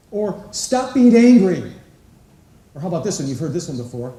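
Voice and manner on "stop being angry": much louder and higher, slow stern command; mock parent reprimand. Parent ordering a child to stop being angry, slow and loud. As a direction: bark it down at the child.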